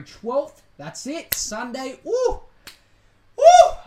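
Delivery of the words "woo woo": very high, loud cheering whoops; excited whoop. Real, not acted. Can't wait for the title fight, loud joyful whoops.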